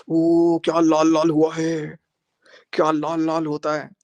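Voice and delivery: loud, drawn-out mock amazement; mocking exclamation. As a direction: stretch it out in loud, taunting mimicry. Mockingly acting out the cheek mark. (नकली हैरानी में खींचकर ताना मारते हुए)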